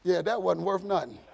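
ordinary talk, nothing marked

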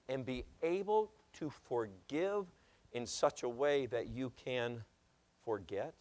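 low, hushed appeal to the congregation; soft pulpit appeal. Real, not acted. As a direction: gently urge them, with long pauses.

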